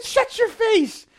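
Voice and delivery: very high, loud and slow; shouted disbelief. Retold or imitated. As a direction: scream it in total disbelief. Barber shouts at second gift.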